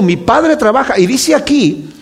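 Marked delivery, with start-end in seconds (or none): 0.0-1.0 "Padre" lifted high and stressed; raised emphasis. Shown as a demonstration. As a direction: pitch "Mi Padre trabaja" up, hitting "Padre". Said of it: "Está diciendo".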